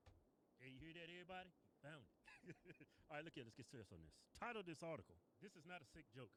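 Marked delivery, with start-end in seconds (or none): none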